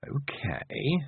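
hesitant, drawn-out thought; careful thought. A narrator's reading. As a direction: think it through warily. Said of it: "she thought carefully".

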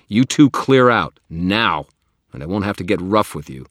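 loud, high warning order; angry command. Acted. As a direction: order them out hard. Wizard orders vampires away.

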